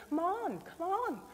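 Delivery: high, eager urging; urging encouragement. Retold or imitated. Holy Spirit urging the congregation onward, high and eager. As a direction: nudge them on, come on. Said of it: "saying to you".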